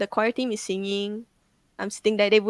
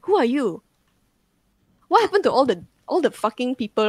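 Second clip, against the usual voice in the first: raised, mildly acted confused questioning; retold bewildered demand; 3 semitones higher, 4 dB louder than usual. Possessed girl not knowing her best friend, retold bewilderment. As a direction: demand answers as if among strangers, lightly acted.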